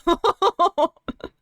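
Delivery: laugh. Real, not acted. Laughing after uh-oh again.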